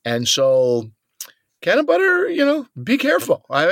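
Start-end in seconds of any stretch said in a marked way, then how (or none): 1.6-3.4 high, emphatic warning; high-pitched cautionary call. Real, not acted.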